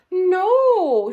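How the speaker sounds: high, loud, final refusal; firm no. Acted. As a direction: slam the door on the idea. Sheila refuses Jimmy.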